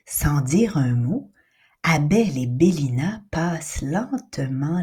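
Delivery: hushed, low narration in a whisper; suspense whisper. A narrator's reading. Narrator whispering as they enter the attic.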